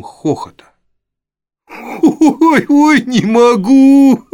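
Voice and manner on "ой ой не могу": high squealing laughing wail; a boy crying out mid-laugh; howling with laughter. Acted. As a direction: shriek it out through laughter. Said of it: "вопил Чарльз Бейтс".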